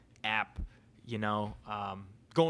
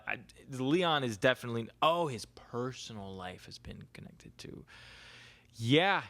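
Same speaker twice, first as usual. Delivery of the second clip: ordinary talk, nothing marked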